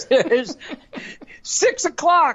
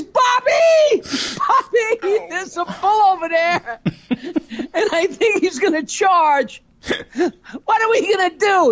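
hammed-up panicked wife; mock panic; 5 semitones higher, slower than usual. Wife warns husband about the bull, mock panicked.

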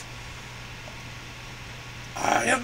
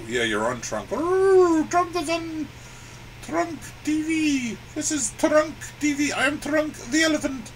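normal talking voice

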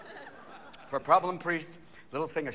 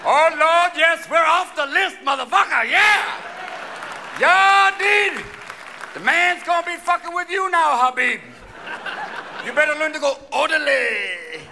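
loud, high whooping celebration; joyful shouting; 9 semitones higher, 6 dB louder than usual. Retold or imitated. Men in the boarding line shouting with relief, high and loud. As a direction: holler it out like you just got off the hook.

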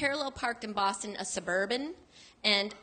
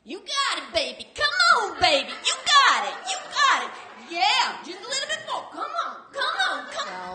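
much higher, loud cheering coach voice; cheering panhandler; 11 semitones higher, 8 dB louder than usual. Retold or imitated. Panhandler cheering her parallel parking, loud and high. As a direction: egg her on like a hyped sidewalk coach. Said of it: "but I hear".

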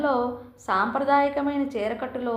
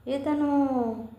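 very slow, drawn-out puzzled "who's this?"; slow wondering query about a stranger; puzzled question; 2 semitones higher, much slower than usual. A narrator's reading. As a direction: stretch the word out, unsure who he is. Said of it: "ప్రశ్నార్థకంగా చూసి".

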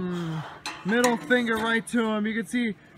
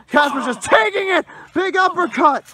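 high, punchy wrestling call; excited play-by-play; 8 semitones higher, 5 dB louder than usual. Real, not acted. Commentator calls a big uppercut, excited play-by-play. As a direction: jump on each blow as it lands. Commentator calling heavy blows.

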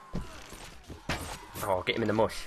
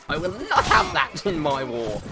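higher-pitched mock indignation; mock outrage; 6 semitones higher than usual. Player spotting a horse still standing, mock outrage. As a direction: play the outraged warlord.

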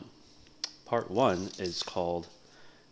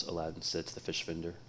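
usual speaking voice

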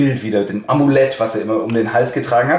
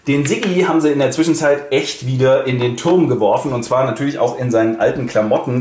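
usual speaking voice

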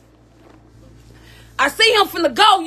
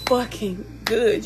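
slow, much softer, lower teary praise; tearful thanks; 7 semitones lower, 10 dB quieter, slower than usual. Real, not acted. Woman thanking God after the robbery, slow and teary. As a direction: breathe it out through tears.

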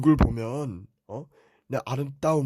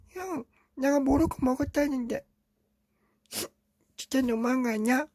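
put-on high little-kid voice tattling to dad; little brother voice; 10 semitones higher than usual. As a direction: whine it like a small child telling on his brother. Younger Teletubby protests to brother.

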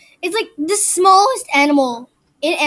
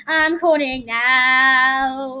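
very slow drawn-out; 3 dB louder, much slower than usual. Pretend. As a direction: stretch every word out slowly. Slows the phrase down further.

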